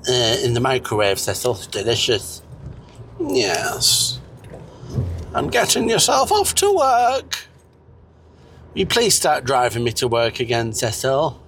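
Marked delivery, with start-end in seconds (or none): 5.3-7.5 high character voice. Acted.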